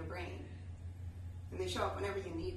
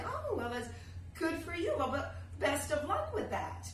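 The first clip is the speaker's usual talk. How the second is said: pat her on the head, sing-song; condescending brush-off; 6 semitones higher than usual. Retold or imitated. Star brushing off an eager artist, dismissive sing-song. Celebrity snubs emerging artist.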